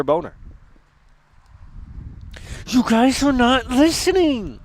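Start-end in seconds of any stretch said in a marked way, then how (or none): 2.7-4.6 high, slow petulant whine; whiny mock voice. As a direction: whine like a scolded kid.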